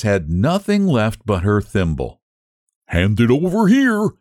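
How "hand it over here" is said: high, bossy bird voice; dodo voice. Acted. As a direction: raise the pitch for the dodo. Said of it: "said the dodo".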